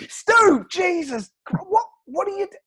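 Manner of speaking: shocked. Retold or imitated. Host shocked again.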